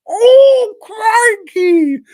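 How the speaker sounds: very high, loud hammed-up shriek of horror; mock scream. The brother seeing his dead pet, with a hammed-up high shriek. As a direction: shriek it like a cartoon fright. Brother finds skinned sheep.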